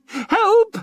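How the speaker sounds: very high, loud terrified shriek; a high, loud panicked shriek. A narrator's reading. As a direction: scream for help in panic. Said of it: "she shrieked".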